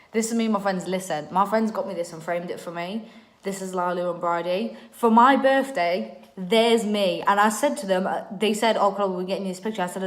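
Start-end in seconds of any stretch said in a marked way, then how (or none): 4.9-9.8 excited storytelling. Real, not acted. Excitedly telling the birthday story.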